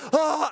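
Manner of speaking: high, loud mock scream; imitated panicked yell. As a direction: act out your own frightened holler. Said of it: "And I'm just like".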